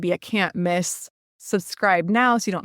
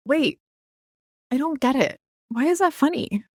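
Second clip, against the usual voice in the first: feigned confusion in a higher pitch; playing dumb; 6 semitones higher than usual. Listener making someone explain a bad joke, playing dumb. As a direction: act innocently puzzled by the joke. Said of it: "You're gonna play dumb".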